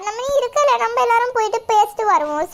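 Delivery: ordinary delivery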